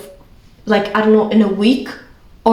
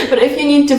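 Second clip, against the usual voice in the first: higher, amused and laughing at yourself; 4 semitones higher, faster than usual. Real, not acted. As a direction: talk through a laugh. Laughing at own accent slip.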